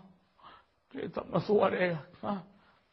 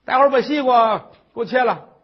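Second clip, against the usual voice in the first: loud, high nagging tirade; shrill scolding old mother; 6 semitones higher, 10 dB louder than usual. Acted. Mother-in-law scolds the crying daughter-in-law, loud and high.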